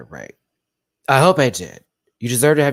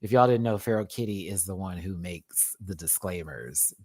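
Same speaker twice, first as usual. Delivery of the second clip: ordinary delivery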